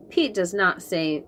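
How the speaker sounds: ordinary delivery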